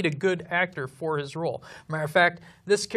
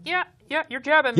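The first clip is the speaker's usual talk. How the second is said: higher, louder dismissive brush-off; mock Sandra Bullock; 7 semitones higher, 5 dB louder, faster than usual. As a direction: tease her shrugging off the jab, pitch up. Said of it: "and Sandra Bullock going".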